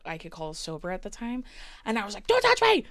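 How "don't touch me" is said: 1 shrieking refusal. Retold or imitated. Drunk self pushing a stranger away.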